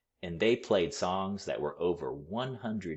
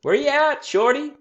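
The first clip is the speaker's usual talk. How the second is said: much higher and louder call from a window; 12 dB louder than usual. A narrator's reading. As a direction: holler across the street to the boy. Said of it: "Queen Lola called out the window".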